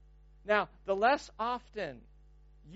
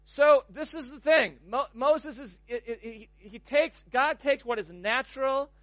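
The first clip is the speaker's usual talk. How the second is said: normal talking voice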